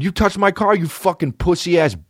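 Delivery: re-enacted high-pitched rant; retold angry scream. Driver raging at the man who touched his car, retold yelling. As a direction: throw out the insult in a high mock yell. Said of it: "I'm screaming at this guy".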